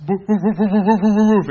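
loud rapid woofing; imitated dog barking. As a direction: bark like an agitated dog. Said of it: "Max starts barking".

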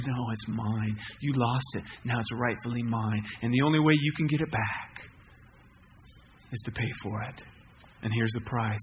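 name your price without pity; stern store owner. Acted. Store owner to boy, low firm demand.

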